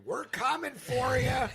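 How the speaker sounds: high, loud holler with stretched words; screaming. Retold or imitated. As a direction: yell it out slow and loud from a tractor seat. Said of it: "me hanging out of a tractor seat screaming".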